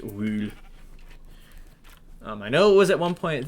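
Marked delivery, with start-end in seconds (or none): 0.0-0.5 "wool" with a long oo; alternate pronunciation. Shown as a demonstration. Player wondering about old English, "wool" with a long oo. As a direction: say "wool" with the vowel of "pool". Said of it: "if it was ever pronounced".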